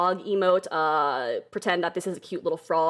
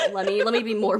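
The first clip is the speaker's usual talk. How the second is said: ordinary delivery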